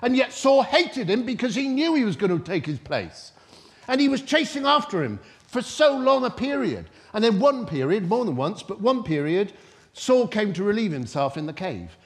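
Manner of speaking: high, loud, driving sermon delivery; full-force preaching. Real, not acted. Preacher telling the church how Saul hunted David, full-force preaching.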